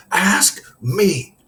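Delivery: punched, high-pitched retort; stern coach firing it straight at him; insistent, exasperated command; intense demand. A narrator's reading. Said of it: "now laser beaming straight at me".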